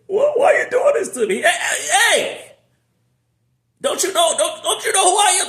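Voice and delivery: high, loud, comic scolding shout; hammed-up angry God; God scolding Job. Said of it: "This is what God said".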